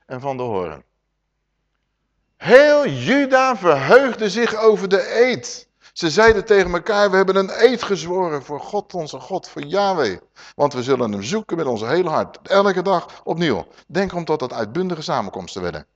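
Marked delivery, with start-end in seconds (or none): 2.4-13.7 exultant scripture reading. Acted. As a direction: proclaim Judah's joy. Reads Judah's rejoicing verse.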